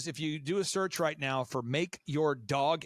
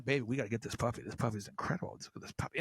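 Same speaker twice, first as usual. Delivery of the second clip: hushed husband plea; 7 semitones lower, 6 dB quieter, faster than usual. Retold or imitated. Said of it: "the guy's going".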